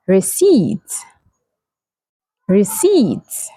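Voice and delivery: "receipt" as ri-SEET, no p; slow model "receipt", pausing before the repeat; silent P. Shown as a demonstration. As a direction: rhyme "receipt" with seat. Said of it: "So the P is silent".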